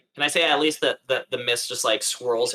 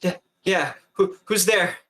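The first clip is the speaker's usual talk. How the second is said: acted panting; 7 semitones higher than usual. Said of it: "almost panting".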